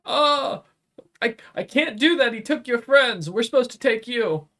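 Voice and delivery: high, timid little imp; nervous imp voice. Acted. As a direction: squeak it out, scared of the noble.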